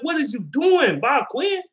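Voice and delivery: angry shout. Retold or imitated. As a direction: yell it at the GM, fed up. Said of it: "I'm like".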